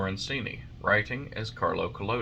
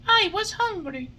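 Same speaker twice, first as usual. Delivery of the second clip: put-on high, louder puppet boy pleading; Pinocchio; 5 dB louder than usual. A narrator's reading. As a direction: protest like a hungry child.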